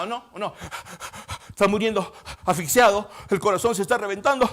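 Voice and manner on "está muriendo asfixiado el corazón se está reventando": loud, high, gasping phrases; acted suffocation. Preacher acting out a crucified man suffocating, loud and gasping. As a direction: heave for air between the phrases.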